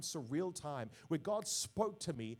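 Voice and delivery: normal talking voice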